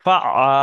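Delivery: ফা আ with আ squeezed from mid-throat, loud; throaty ayn. Shown as a demonstration. Teacher shows where ayn comes from, loud mid-throat আ in ফা আ. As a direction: say ফা আ loudly, making the আ an Arabic ayn from the middle of the throat. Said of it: "আইন গলার মাঝখান থেকে উচ্চারণ হয়".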